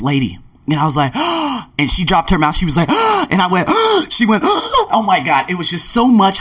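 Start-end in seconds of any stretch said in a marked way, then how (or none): 1.2-1.7 sharp surprised gasp; gasp of surprise. Retold or imitated. Surprised gasp on seeing her. 3.7-5.5 surprised gasps. Retold or imitated. Mutual gasps at reunion.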